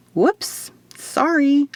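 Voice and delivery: high, quick "Whoops! Sorry"; startled apology shout. A narrator's reading. Woman calls an apology after the door nearly hits Will, startled. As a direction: yelp it out in surprise. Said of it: "I shouted".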